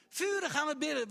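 loud zealous prayer voice, high; fervent loud prayer. Retold or imitated. Preacher voicing a fervent church at prayer, loud and high. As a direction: shout it like a fervent prayer meeting.

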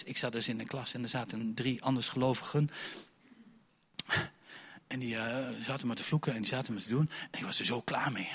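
ordinary talk, nothing marked

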